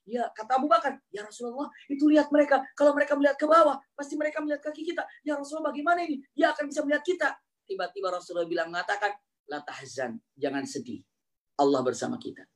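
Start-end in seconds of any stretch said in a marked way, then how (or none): 1.1-7.3 high, hurried frightened plea; worried Abu Bakar. Acted. Abu Bakar pointing out the enemies to the Prophet, high and hurried. 9.5-12.5 soft, low reassurance; calm consolation. Acted. The Prophet comforting Abu Bakar, soft and calm.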